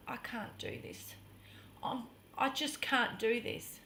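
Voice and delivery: very soft, near-whispered confession; frightened plea. Retold or imitated. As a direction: shrink into a small, scared hush. Acts frightened plea to partner.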